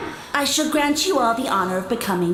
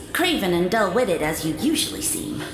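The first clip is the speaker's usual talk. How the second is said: ordinary talk, nothing marked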